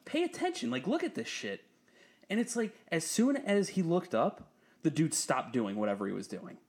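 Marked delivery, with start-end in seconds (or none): 0.0-1.6 high, urgent call to a coworker; urgent nudge. Retold or imitated. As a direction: pitch leaps on yo. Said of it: "I'm like".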